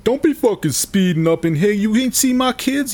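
high, loud confrontational stranger's voice; angry thug. Retold or imitated. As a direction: put on an outraged dad challenging a careless driver. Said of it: "a man who looks like [name]".

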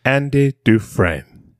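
slow, drawn-out narrator impression; Morgan Freeman. Trying a better impression, lightly voiced slow Morgan Freeman. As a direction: stretch the name out in measured narration. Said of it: "you've done a better Morgan".

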